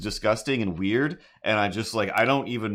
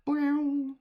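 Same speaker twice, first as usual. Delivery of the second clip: high cartoon boing; spring boing way up high; boing sound effect. Sound effect ending the song.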